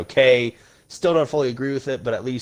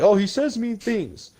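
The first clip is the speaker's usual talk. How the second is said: mocking whiner; 9 semitones higher, slower than usual. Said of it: "to be like".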